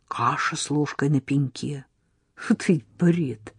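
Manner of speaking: plain reading voice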